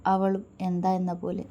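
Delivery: plain reading voice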